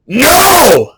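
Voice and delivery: "no!" shouted from the gut; full shout. Shown as a demonstration. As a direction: belt out the word "no!" with full force. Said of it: "not just no, but".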